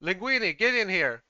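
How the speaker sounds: angry chef bellowing across the kitchen; calling out. Acted. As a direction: shout for your underling to come. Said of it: "before he finally calls out".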